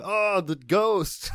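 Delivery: high, slow spooky ghost moan; hammed-up ghost voice; mock ghost voice. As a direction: stretch it out like a silly ghost. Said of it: "Just be like".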